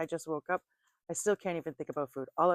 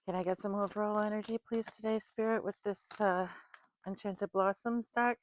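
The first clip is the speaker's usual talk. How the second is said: higher, sweet, pleading prayer voice; sing-song request to the spirits; invocation to the spirits; 5 semitones higher than usual. Acted. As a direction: call on the angels politely, lifting the pitch. Invoking spirit for the deck.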